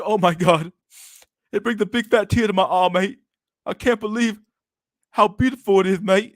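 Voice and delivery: high, loud mock-moved voice; mock tearful admirer. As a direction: imitate someone overcome by beauty. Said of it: "and you're crying. He's like".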